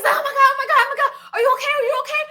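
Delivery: high, loud panicked "are you okay"; worried neighbor. Retold or imitated. Neighbor lady rushing out to the screaming narrator, high and panicked. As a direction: play the alarmed lady next door. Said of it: "and says".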